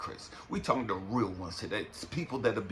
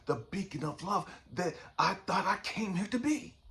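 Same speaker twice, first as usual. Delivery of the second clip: impassioned preaching; 7 semitones higher than usual. Acted. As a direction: rise from lament to protest. Fervent preaching, builds again.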